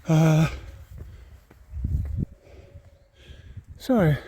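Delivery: breathless from the climb; panting between words; out of breath. Real, not acted. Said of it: "just getting out of breath".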